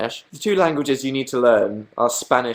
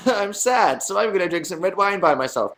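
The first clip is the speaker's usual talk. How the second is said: mock moping; 6 semitones higher than usual. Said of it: "And now I'm like".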